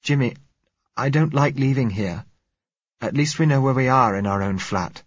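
pleading nervously with her husband; frightened young woman. A narrator's reading.

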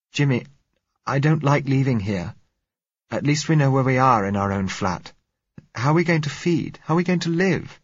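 put-on high, anxious young woman; frightened girl voice. A narrator's reading. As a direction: voice a scared girl pleading.

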